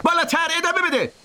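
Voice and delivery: high yell up the tree; shouted order. A narrator's reading. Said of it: "ارنی داد زد".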